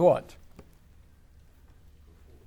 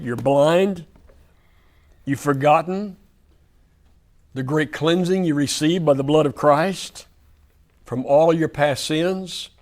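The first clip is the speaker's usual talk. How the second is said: louder, driving sermon delivery with hammered phrases; preaching at full force; 2 semitones higher, 4 dB louder than usual. Acted. Preacher warning the congregation, forceful sermon voice. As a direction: push it like a preacher warning his flock.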